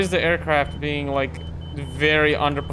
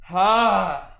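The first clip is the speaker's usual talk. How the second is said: high, frustrated "ah"; higher-pitched groan of frustration; frustrated cry; 5 semitones higher, 4 dB louder than usual. Real, not acted. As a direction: let out an exasperated cry. Frustrated with failing controller.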